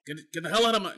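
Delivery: Brian Cox impression. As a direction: bark it like a furious media boss. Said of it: "I wish I could do a good Brian Cox impression".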